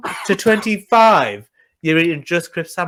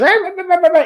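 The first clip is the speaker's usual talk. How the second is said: put-on toddler munching noises; 12 semitones higher than usual. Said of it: "she goes".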